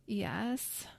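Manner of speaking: hammed-up sick croak; exaggerated croak. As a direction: croak it like a sore throat. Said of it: "with an exaggerated croak".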